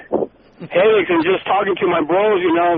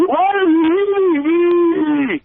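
higher, slow, pleading whine; clingy wife impression; 7 semitones higher, slower than usual. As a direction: drag it out like an abandoned wife. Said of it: "do their best impression of the little lady".

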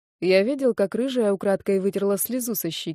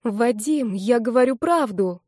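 higher, imploring voice; almost pleading; 4 semitones higher than usual. A narrator's reading. Redhead swears to Vadim she's honest, pleading. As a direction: beg to be believed. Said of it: "почти взмолилась она".

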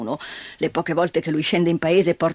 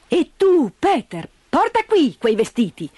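raised, commanding woman's voice; bossy aunt; 9 semitones higher than usual. Acted. Dete bosses Peter around, raised commanding voice. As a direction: order the boy about briskly.

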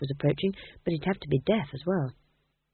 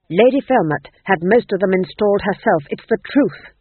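louder, pleading protest; desperate; 2 semitones higher, 8 dB louder than usual. Acted. Ghost king protesting his innocence to Nanny, desperate and louder. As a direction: insist anxiously on his innocence. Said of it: "said the king desperately".

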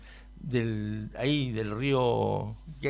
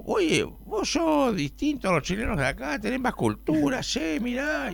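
friendly Uruguayan voice pitched higher; lightly imitated Rioplatense lilt with vos and che; Uruguayan accent; 12 semitones higher than usual. Friendly Uruguayan flattering the Chilean traveller, light Uruguayan accent. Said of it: "Un uruguayo muy simpático me dijo".

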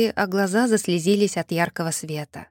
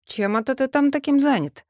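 higher-pitched, mildly suspicious query; a touch of disapproving curiosity, pitched up; frowning question; 6 semitones higher than usual. A narrator's reading. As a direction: ask with a puzzled frown. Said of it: "нахмурился Даркхолд".

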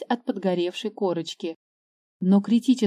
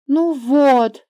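put-on upset girl; 5 semitones higher, 6 dB louder than usual. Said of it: "расстроилась девочка".

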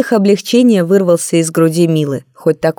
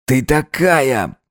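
put-on awestruck young man; 3 semitones lower, much slower than usual. Vova stunned by her look.

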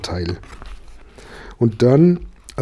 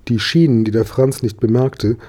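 ordinary delivery

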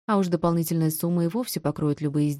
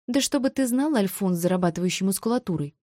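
ordinary delivery

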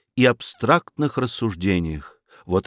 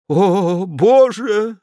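high, loud anguished exclamation; despairing cry; 7 semitones higher, 4 dB louder, much slower than usual. A narrator's reading. Fedyashev cries out in despair to heaven. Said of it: "в отчаянии воскликнул".

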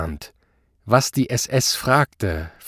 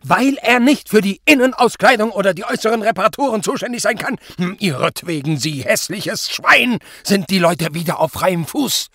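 high-pitched, strained scolding; furious tirade; 10 semitones higher, 3 dB louder than usual. A narrator's reading. Superior berates Zott over freed suspects, furious. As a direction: rant on and end on a louder insult. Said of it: "fuhr Prall in die Höhe".

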